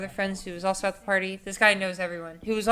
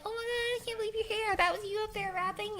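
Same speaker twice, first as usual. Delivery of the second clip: put-on much higher, breathy-sounding amazed girl voice; starstruck girl; 13 semitones higher, 4 dB quieter than usual. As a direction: gush like an impressed fan. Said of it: "she's like".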